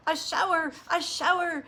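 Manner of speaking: put-on high, loud squealing kids; excited mock scream of children; children's scream. A narrator's reading. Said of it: "screamed Missus Ralph's four children".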